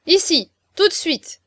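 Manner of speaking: high, loud order; commanding. A narrator's reading. La Nivelle summoning docile Oriol to her side, commanding loudly.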